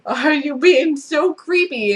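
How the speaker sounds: high, loud what-are-you-doing; high-pitched disbelief at the creepy man; incredulous. Retold or imitated. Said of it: "it's like".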